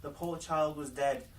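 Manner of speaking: plain reading voice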